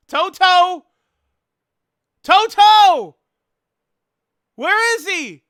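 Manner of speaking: high, loud searching holler; calling out. Pretend. Streamer hunting for commenter Toto, hollering loudly. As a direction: holler for someone lost, playing it up.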